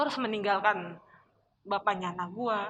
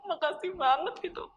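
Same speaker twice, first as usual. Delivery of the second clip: tearful relief; 7 semitones higher than usual. Real, not acted. Relief in tears.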